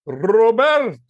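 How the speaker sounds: high, grandly rolled greeting; exuberant, drawn-out mock-grand hello; Leslie Nielsen. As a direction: hail someone with a theatrical trilled R. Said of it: "He goes".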